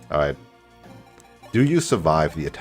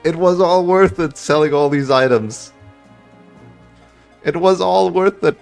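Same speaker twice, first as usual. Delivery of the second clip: loud, high, thrilled yelling; excited shouting; 11 semitones higher, 5 dB louder than usual. Real, not acted. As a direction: cheer the winning crit at full voice. Big crit wins the final battle.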